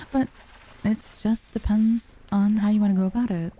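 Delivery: ordinary talk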